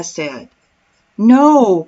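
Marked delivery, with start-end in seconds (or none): 1.2-1.9 loud one-word exclamation; exclaiming. A narrator's reading. Husband answers wife about the missing dinghy, exclaiming. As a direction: burst it out, louder than the telling. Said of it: "Paul exclaimed".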